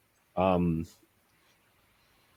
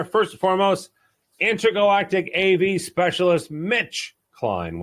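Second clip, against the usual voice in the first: announcer introduction; 9 semitones higher, 3 dB louder than usual. Real, not acted. Host introducing the guest, hammed-up announcer. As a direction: present the guest like a ring announcer. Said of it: "host introducing guest".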